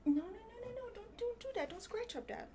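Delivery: high, soft pleading no; gentle high-pitched scolding of a pet; stopping the cat. Real, not acted. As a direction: coax the cat to stop scratching. Talking to her cat, stopping it.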